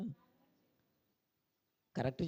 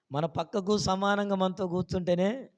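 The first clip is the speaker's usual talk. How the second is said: ordinary talk, nothing marked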